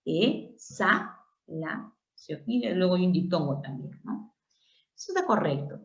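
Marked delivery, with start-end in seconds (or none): 0.0-1.9 Exhalación softly split as e-xa-la-ción; syllable by syllable. Shown as a demonstration. Teacher showing X and A form one syllable, segmented. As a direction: space out Exhalación with X joining the A. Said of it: "forman siempre una sola sílaba".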